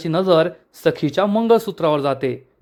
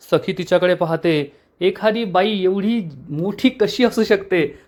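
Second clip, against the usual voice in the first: ordinary delivery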